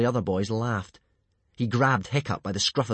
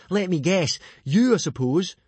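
higher, dry sarcastic guess; 9 semitones higher, 6 dB louder than usual. Acted. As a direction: roll your eyes as you guess. Fishlegs mocking Snotlout.

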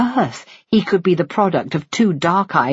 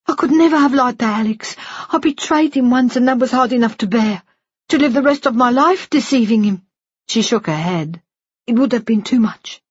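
put-on higher, tearful older woman pleading; Missus Shinwell voice; 8 semitones higher than usual. Woman confessing to the nurse, high and tearful. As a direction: voice her high and aching with guilt.

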